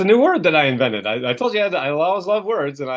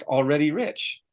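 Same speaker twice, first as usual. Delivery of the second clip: usual speaking voice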